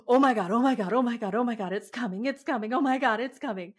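high, repetitive mock dread; imitated panic. Mom squeezing teen's hand in the dark, mock panic. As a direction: play a mom panicking before the drop. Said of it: "actually lost her shit on a roller coaster".

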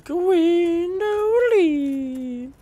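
long, very high wail of the name; playful high singsong summons; falsetto call. Pretend. Calling out for the boss.

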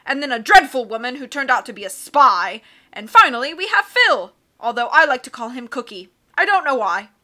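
loud, hearty captain voice; blustery sea captain. Acted. Captain puzzling over charts, loud and hearty. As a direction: boom like a cheerful old sea dog.

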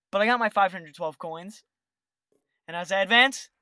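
ordinary talk, nothing marked

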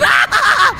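high shrieking scream with a laugh; jumpscare scream. Real, not acted. Gamer hit by jumpscare, shrieking scream.

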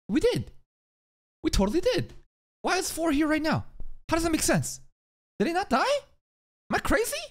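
high, pleading disbelief; incredulous. Real, not acted. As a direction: sure we killed him, so how. Insisting the villain was killed.

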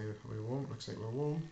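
ordinary delivery

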